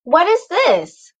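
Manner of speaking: high, loud, slow scolding yell; a mother's outraged shout; mama yelling. Acted. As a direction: cry out like a startled, cross mother. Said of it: "Mama yelled".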